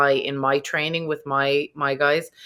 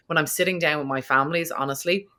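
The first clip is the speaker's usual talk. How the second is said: normal talking voice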